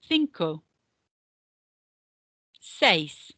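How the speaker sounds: each number said clearly with long gaps, for repeating; slow counting drill. Shown as a demonstration. Teacher modelling Spanish numbers for pupils to repeat, slow and clear.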